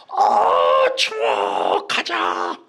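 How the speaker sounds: loud, drawn-out mock kid's yell; child shouting. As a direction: holler to the parents like a demanding child. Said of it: "막 소리를 지르는 거예요". (아이가 떼쓰듯 크게 외치며)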